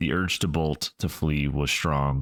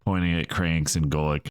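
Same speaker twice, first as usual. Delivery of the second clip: usual speaking voice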